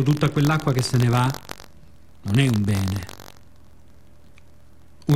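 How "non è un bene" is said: ordinary delivery, nothing marked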